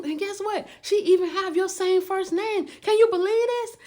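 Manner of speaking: can't believe the coincidence; amazed. Retold or imitated. Telling her friend on the phone, amazed.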